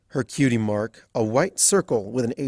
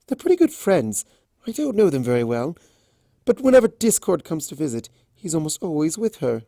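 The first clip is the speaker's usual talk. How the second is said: bright, higher gossipy mare voice; chatty townspony; 5 semitones higher, 4 dB louder than usual. Acted. Earth pony mare telling strangers about Fluttershy, chatty. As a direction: share the gossip eagerly.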